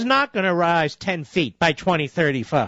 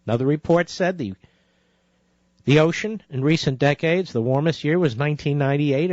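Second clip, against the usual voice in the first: ordinary delivery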